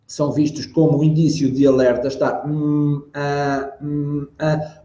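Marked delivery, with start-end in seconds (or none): 2.5-4.8 drawn-out hesitant "hum, ah"; filler sounds. Shown as a demonstration. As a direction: stall with long ums and ahs. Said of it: "os enchimentos".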